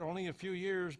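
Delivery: usual speaking voice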